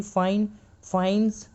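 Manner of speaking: फाइन्स ending in z after फाइन; z sound at end. Shown as a demonstration. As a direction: contrast फाइन and फाइन्स with a voiced z. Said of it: "इसको एनएस नहीं पढ़ेंगे".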